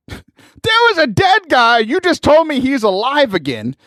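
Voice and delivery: high-pitched, exasperated disbelief; incredulous rant. Acted. Man argues with angel about dead guy, incredulous. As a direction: argue back in comic astonishment, pitch jumping.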